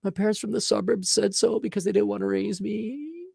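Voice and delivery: mock-whimpering high voice with a laugh; whiny loser. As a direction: ham up a self-pitying loser.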